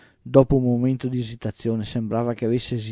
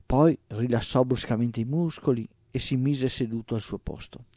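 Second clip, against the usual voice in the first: ordinary delivery, nothing marked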